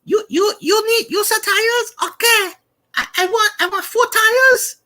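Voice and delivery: very high, stretched-out goofy caller; prank-call customer voice; disguised voice. Shown as a demonstration. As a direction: stammer slowly through a high put-on voice. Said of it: "I'm trying to drop it down".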